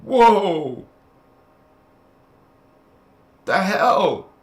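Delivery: loud high startled whoa; shocked outburst. Real, not acted. Reacting in disbelief to a wrathful God, loud and high. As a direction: jolt back in disbelief.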